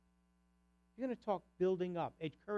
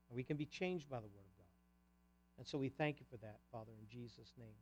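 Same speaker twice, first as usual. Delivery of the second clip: prayer; 4 semitones lower than usual. Acted. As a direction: pray over the congregation, gently. Closing prayer before communion.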